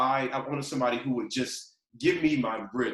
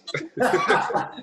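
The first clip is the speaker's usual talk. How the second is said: breathy laugh; 13 semitones higher, 10 dB louder than usual. Real, not acted. Laughing at record books joke.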